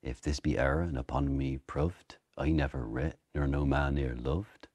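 Shakespeare's original-pronunciation accent. Shown as a demonstration. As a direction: rhyme proved with loved in an Elizabethan accent. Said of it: "in original pronunciation".

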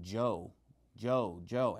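"Joe" said with a J sound for yo; retold Spanish "yo" hardened to "Joe"; imitating South American "yo". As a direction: say "Joe" the way the Spanish teacher did. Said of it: "he was pronouncing it Joe".

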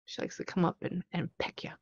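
hushed, breathless whisper. Shown as a demonstration. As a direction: drop to a secret whisper. Showing the spirit's soft kisses.

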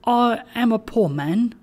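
high-pitched, humble and a touch hesitant; faltering. A narrator's reading. Trotty excuses himself to the bell, mildly faltering. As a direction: falter as a poor man before a power. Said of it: "faltered Trotty".

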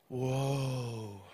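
low, soft, drawn-out whoa; calming horse whisperer. Retold or imitated. Acting out partner calming the horses.

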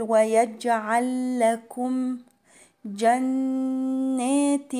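"ويجعل لكم" chanted slowly on a doubled l, "جنات" long and hummed; doubled laam. Shown as a demonstration. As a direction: merge the l in "ويجعل لكم", then hold the n of "جنات". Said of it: "فهنطق لامين بس". ("ويجعل لكم" بلام مشددة و"جنات" بغنة)

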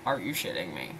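very low, softened disbelief; hushed, low-pitched incredulity; stunned disbelief. Retold or imitated. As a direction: drop it low and soft, floored. Stunned reaction to surprise gift.

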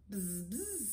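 two soft bee buzzes; soft storybook bee noise. A narrator's reading. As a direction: buzz quietly like a bee. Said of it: "says Bee".

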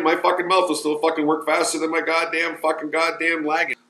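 usual speaking voice

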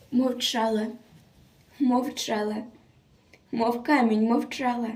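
softly, each phrase set apart by silence; hushed solemnity. A narrator's reading. Reciter tells of the girl's stony silence, hushed solemnity. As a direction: drop to a soft, grave hush. Hushed after the torture climax.

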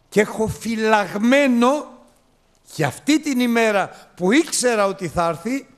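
high, loud exultant proclamation; fervent preaching. Acted. Preacher voicing the father ordering robes for the son, loud and high. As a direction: proclaim the father's joy at full voice.

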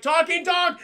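high, loud cry of alarm; comic panic. Acted. Jack confronted by a talking dog, panicked shout. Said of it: "I love his panic".

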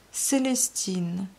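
drawn-out, savoring the name; slow, thoughtful echo of a name; slow musing repeat. Acted. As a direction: roll the name around slowly.

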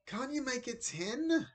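put-on high, drawn-out child's plea; pleading boy. A narrator's reading. Ben begs Dad for an earlier pickup, high slow plea. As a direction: plead up high, stretching the words.

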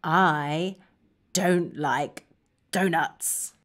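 low, quiet, halting; subdued, word-by-word refusal; glum dislike. Shown as a demonstration. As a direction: drop down soft and disappointed. Modelling dislike of donuts.

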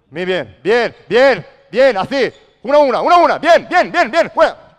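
high, very loud cheering with a laugh; excited cheering. Real, not acted. Coach thrilled with his players, loud laughing cheers. Excited praise after a good play.

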